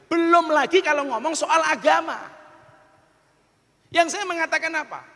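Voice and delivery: high, emphatic sermon voice; forceful preaching. Real, not acted. Preacher warning of conflict between tribes and religions, forceful preaching. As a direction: warn the crowd with rising urgency.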